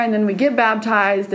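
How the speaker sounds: ordinary talk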